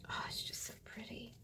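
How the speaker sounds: soft, breathy whisper of delight; admiring whisper. Real, not acted. Crafter admiring old paper in a soft whisper. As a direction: whisper it like a secret treasure.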